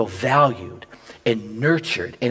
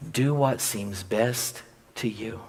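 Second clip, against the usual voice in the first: tender; 3 semitones lower, 8 dB quieter, slower than usual. Acted. Husband's tender reply to wife.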